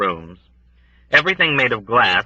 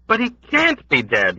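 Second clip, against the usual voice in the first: insistent cry; 14 semitones higher than usual. A narrator's reading. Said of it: "Yossarian cried".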